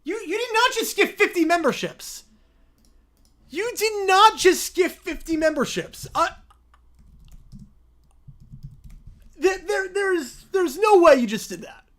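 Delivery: high, loud, near tears; overjoyed shouting. Real, not acted. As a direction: shout in shocked joy. Reacting to gift.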